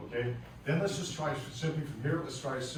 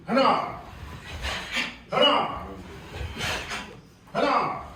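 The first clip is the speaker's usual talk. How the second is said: sharp high yelled count; high shouted count; 10 semitones higher, 8 dB louder, slower than usual. Real, not acted. Instructor yelling the count to the class, high and loud. Martial arts count called out.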